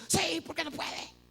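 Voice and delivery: high, drawn-out indignant cry; embittered child retort. Retold or imitated. Boy answering his mother about the shoes, indignant. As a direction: fire back like an aggrieved kid. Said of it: "yo me comencé a amargar".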